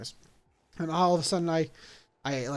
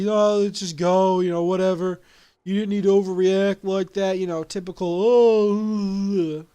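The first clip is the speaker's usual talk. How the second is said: louder, breezy brush-off in a changed voice; dismissive friend; 2 semitones higher, 5 dB louder than usual. Retold or imitated. Friend waves off the overreaction, dismissive and louder. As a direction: shrug it off like it's nothing.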